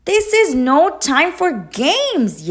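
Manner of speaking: high, loud scolding yell. A narrator's reading. Goose yells at the blue bird, loud and scolding. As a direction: tell her off sharply. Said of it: "yelled Goose".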